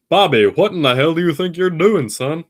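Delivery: high, loud scolding cartoon dad; Hank Hill impression. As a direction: bellow at Bobby like a fed-up father. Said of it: "a good Hank Hill line".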